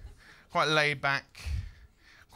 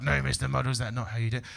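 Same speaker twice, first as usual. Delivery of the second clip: gently scolding, softer and lower; a hint of a patient presenter correcting; mild mock kids' TV telling-off; 6 semitones lower, 4 dB quieter, faster than usual. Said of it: "went along the lines of this".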